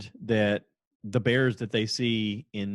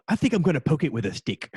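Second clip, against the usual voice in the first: mock daredevil host; 5 semitones higher, 4 dB louder than usual. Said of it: "have him once in a while go".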